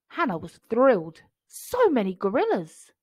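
ordinary delivery, nothing marked

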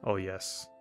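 usual speaking voice

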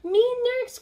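high, loud eager shout; eager cry. Acted. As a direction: call out to go first. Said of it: "cried Spike again".

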